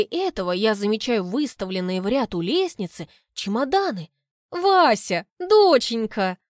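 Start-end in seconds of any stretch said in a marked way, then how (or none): 4.5-6.4 a hint of a honeyed mother, louder; syrupy sweet greeting; sugary mother. Mother greets daughter sweetly, a light honeyed call. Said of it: "елейным голосом".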